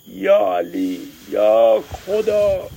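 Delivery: high loud slow wail to God; crying out to God. Acted. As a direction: drag out the plea in pain. فریاد کمک خواستن در درد.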